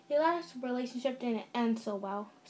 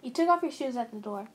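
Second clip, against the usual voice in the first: ordinary delivery, nothing marked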